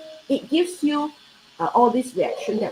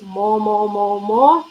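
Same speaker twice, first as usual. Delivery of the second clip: more, more chanted with rising force; repeated more stressed; 5 dB louder, much slower than usual. Shown as a demonstration. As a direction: drill the word more into them, louder each time. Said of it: "put it in".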